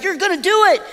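put-on high, loud childish accusation; accusing toddler. Daughter accusing her dad, high and loud. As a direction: whine it like a suspicious toddler. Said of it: "she's like".

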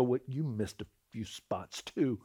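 low, slow, soft comeback; imagined retort. Retold or imitated. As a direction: say it slowly, held back. Imagined retort he held back.

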